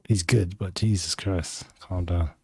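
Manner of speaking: ordinary delivery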